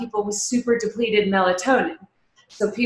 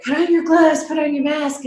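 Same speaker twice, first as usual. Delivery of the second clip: higher-pitched mock husband's orders; imitating nagging husband; 6 semitones higher than usual. Wife quoting husband before a grocery trip, mock nagging. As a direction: lightly mimic a spouse issuing reminders. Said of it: "my husband's like".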